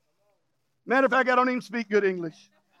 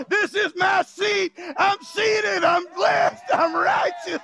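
high, shouted, almost crying; impassioned preaching; 5 semitones higher, slower than usual. Acted. Preacher voices the saved believer, shouting and near tears. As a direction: climb higher with tearful joy.

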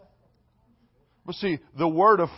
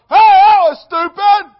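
high, loud mock scolding from the stands; parent yelling; 14 semitones higher, 12 dB louder, slower than usual. Parent at the game yelling at their child, mock scolding shout. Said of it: "the parents are going".

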